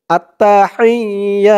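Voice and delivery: আত্তাহিয়া with the ইয়া stretched one alif long; elongated ya vowel. Shown as a demonstration. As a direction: hold the ইয়া for one beat. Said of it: "এক আলিফ টান দিতে হবে".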